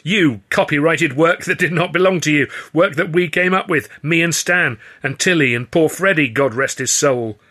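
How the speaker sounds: high, loud accusing tirade; angry shout. A narrator's reading. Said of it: "I shouted".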